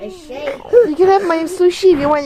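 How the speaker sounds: high, loud, soothing through her own tears; mother consoling her sobbing daughter, tearful; tearful comforting. Real, not acted.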